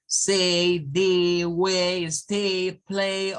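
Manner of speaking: "Say", "way", "stay", "play" each with a clear long A; long A words. Shown as a demonstration. Teacher drills long A words for the class. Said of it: "terminan con ese diptongo".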